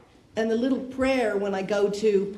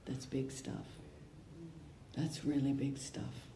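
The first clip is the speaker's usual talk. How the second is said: much softer and lower, letting it sink in; hushed reflection; 4 semitones lower, 12 dB quieter, slower than usual. Real, not acted. Speaker reflects after the prayer, hushed and low. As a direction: drop right down, almost to yourself.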